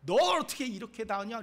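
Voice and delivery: a touch of exasperated rebuke; God getting angry. Acted. Preacher as God scolding Moses, mildly angry. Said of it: "하나님께서 막 화를 내세요".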